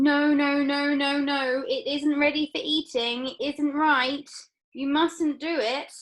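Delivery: high, loud pleading; frantic Wonka. Acted. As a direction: beg her to stop, slowing at the end. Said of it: "and saying".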